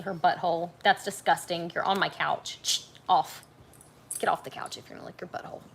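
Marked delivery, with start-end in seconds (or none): 2.6-5.6 fast whispered shooing; whispered reprimand to the dog; hushed scolding. Real, not acted. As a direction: hiss the dog off the couch.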